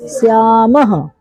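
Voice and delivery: श्यामः said slowly, ending in a breathy ह sound; teacher modelling the visarga sound. Shown as a demonstration. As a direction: drag out श्यामः and breathe out the final ह. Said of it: "विसर्ग के जगह पे हम लोग हो का प्रयोग".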